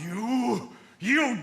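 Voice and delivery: disbelieving fury; outraged shout. Acted. Husband hearing of his wife's lover, outraged shout.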